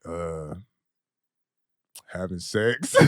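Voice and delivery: hushed, reluctant admission; sheepish confession. Retold or imitated. To his partner's mom, a sheepish hushed admission. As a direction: mumble it out like you're caught. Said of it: "I was like".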